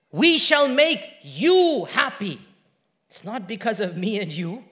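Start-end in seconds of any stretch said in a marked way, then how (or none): 0.1-2.4 slow, loud, high proclamation; emphatic, stretched words at raised pitch; proclaimed promise. Retold or imitated. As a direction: ring it out with conviction. Same promise, tender then proclaimed.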